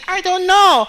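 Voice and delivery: student answering the instructor in a high squeak; squeaky student voice. Retold or imitated. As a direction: voice the hapless student, high and meek.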